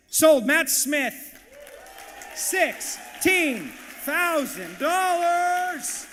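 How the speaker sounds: loud, high auctioneer's closing cry; triumphant sale shout. Real, not acted. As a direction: shout it out, then stretch the final words hugely.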